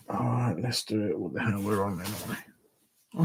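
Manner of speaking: low tired mumble to himself; muttering while searching. Real, not acted. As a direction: mutter under your breath while rummaging. Tired streamer looking for earphones.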